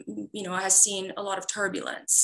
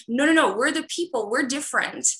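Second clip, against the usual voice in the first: insistent, higher-pitched defender's plea; defensive protest; 6 semitones higher, 5 dB louder than usual. Retold or imitated. Iranian explaining the people are not the regime, insistent protest. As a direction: raise the pitch and push back emphatically, retelling an old argument. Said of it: "as this sort of defender of".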